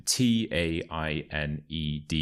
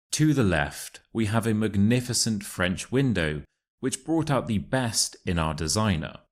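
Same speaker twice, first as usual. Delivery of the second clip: model reading; 4 semitones higher than usual. Shown as a demonstration. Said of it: "listen to the passage and repeat after me".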